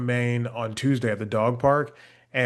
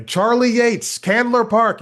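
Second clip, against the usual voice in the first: loud; 9 semitones higher, 6 dB louder, slower than usual. Retold or imitated. Repeating for an older man.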